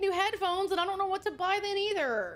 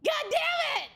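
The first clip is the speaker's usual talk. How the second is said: much higher, a real exasperated yell; frustrated shout; 11 semitones higher, slower than usual. Real, not acted. Streamer cursing to chat about the wasted purchase, shouting. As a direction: throw the curse out in despair.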